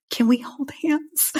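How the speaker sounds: a hint of her post-breathwork bliss, hushed and high; blissed-out ask. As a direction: float the question up softly, like someone overflowing with love. Retold blissed-out moment after breathwork.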